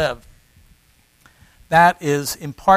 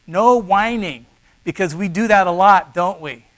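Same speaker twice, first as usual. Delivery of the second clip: ordinary talk, nothing marked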